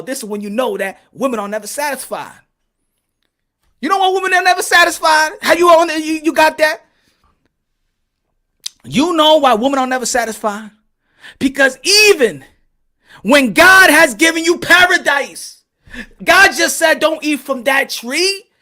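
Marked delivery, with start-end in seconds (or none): 3.8-10.7 excited. Real, not acted. Preaching his theory. 11.4-18.5 preachy build-up. Acted. Mock sermon about God.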